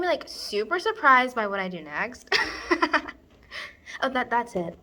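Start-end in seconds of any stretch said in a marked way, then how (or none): 2.4-3.1 high, giggly wordless laugh. Acted. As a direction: giggle at the coming surprise. Laughs at her own wicked plan.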